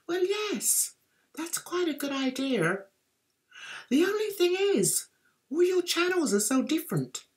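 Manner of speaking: soft, high, halting politeness; mock psychic doubt. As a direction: humour him softly with reservations. Psychics politely doubt his idea.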